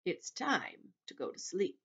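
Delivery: put-on slow, measured elderly grasshopper voice; slow it right down, unhurried; old grasshopper announcing. A narrator's reading. Old grasshopper ending the storytelling, slow and measured. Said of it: "the old green grasshopper announced".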